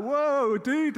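high, loud alarmed whoa; a hammed-up panicked cry; startled whoa. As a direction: back off in fright. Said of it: "I was like that".

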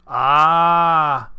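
loud, drawn-out farmer's "Ah"; knowing old farmer. A narrator's reading. Farmer catching Keith's meaning, knowing. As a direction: let realisation dawn as an old rustic.

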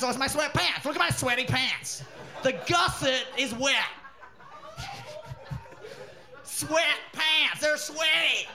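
high, insistent mock American voice; mock American logic. American explains naming of sweatpants, high mock American. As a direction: explain it proudly in a hammed-up American accent.

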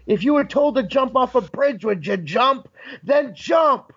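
high acted retort, building to a shout; getting nasty. Wrestler retorting to her excuse, nasty and rising. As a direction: throw it back at her, nastily. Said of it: "I'm getting nasty".

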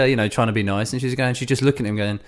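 ordinary delivery

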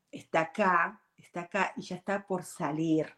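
ordinary talk, nothing marked

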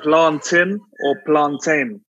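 slow plantain ending in "tin", then plantain ending in "tain"; two pronunciations contrasted. Shown as a demonstration. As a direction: set "PLAN-tin" against "plan-TAYN" for plantain, slowly. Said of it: "Is it pronounced".

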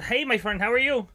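high, loud mock-Irish greeting; put-on accent. Salesman hailing his Irish customer, retold in a borrowed Irish accent. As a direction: greet him in an unintended Irish lilt. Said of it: "I did that in an accent too".

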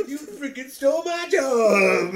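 laughing imitation of a drunk heckler yelling; drunken outburst. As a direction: retell a drunk woman screaming at him, cracking up. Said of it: "she's just like".

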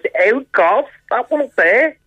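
Scouse accent rising high. Shown as a demonstration. Comedian shows the other Liverpool type, high-pitched Scouse accent. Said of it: "The thing about Scousers, there's two".

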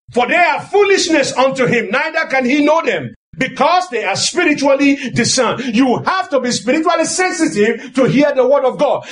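normal talking voice

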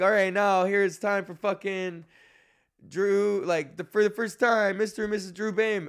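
milk it like the officiant introducing the couple; officiant announcing. Retold or imitated. Officiant presenting the newlyweds to the crowd, lightly hammed up. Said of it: "and then the guy's like".

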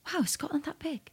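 amazed question, whispered; retold whisper. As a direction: breathe it out in mock disbelief. Retold amazed reaction to distance.

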